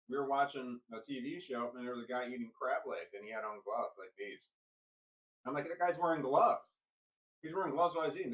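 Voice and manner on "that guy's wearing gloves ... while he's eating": excited pointing out. Retold or imitated. Child pointing out man on TV.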